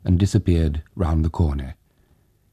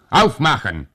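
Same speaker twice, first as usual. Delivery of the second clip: loud, higher German order; German command; 7 semitones higher, 5 dB louder than usual. Acted. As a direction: bark it like a soldier. German soldier at window.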